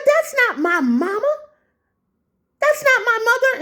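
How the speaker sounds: high-pitched, aghast denial; indignant disbelief. Retold or imitated. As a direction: deny it in an incredulous high wail. Retold indignant denial to cashier.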